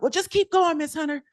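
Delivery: put-on high cheeky child voice; cheeky kids. Pupils cheekily asking to skip their test, high voice.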